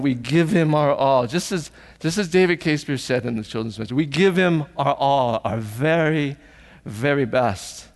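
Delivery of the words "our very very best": normal talking voice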